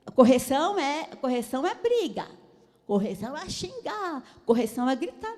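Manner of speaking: loud, emphatic, hammering each phrase; mock scolding. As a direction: punch it out like a quarrelling parent. Mocking parents who equate correction with shouting. (voz alta de bronca, enfática)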